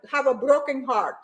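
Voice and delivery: ordinary talk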